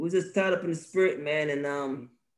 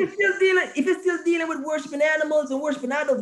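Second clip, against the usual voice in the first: excited; 11 semitones higher than usual. Real, not acted. Raised preaching voice.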